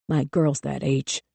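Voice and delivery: slow, low hiss of a furious cop; hissed through gritted teeth. A narrator's reading. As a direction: spit it through clenched teeth. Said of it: "someone hissed between gritted teeth".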